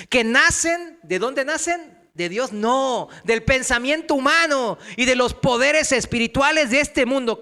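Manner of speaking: loud, high-pitched scripture exposition; forceful preaching. Real, not acted. Preacher asks where false philosophies are born, forceful preaching. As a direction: drive each phrase home loudly. Reads and expounds verse at force.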